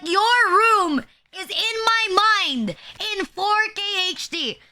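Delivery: high, drawn-out mock outrage; exasperated teasing rant; emphatic ranting. Real, not acted. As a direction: hammer it home, pitched well up. Teasing rant about someone's room.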